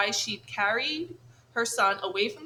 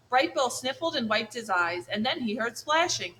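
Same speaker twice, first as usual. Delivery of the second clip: usual speaking voice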